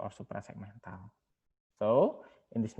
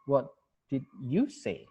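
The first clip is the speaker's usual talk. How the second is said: "you" stressed on a slow rise; slow stressed question; slower than usual. Shown as a demonstration. Teacher modelling a slow rising question, stress on "you". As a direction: draw out "What did you say?" slowly, peaking on "you". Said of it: "itu kan beda-beda".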